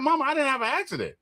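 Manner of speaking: high, fast and bewildered, just woken up; groggy high-pitched confusion. Retold or imitated. Son just woken by mom, high and confused. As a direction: play the dazed son squeaking up in disbelief. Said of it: "I was like".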